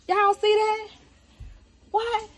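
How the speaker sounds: high, gleeful look at this; proud cook presenting her macaroni; proud show-off. Real, not acted. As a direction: show it off with glee. Showing off the dish.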